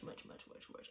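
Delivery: breathless whisper of dread. Real, not acted. Picturing a crash on a busy highway, whispered. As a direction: whisper it under your breath. Dread at how bad it could be.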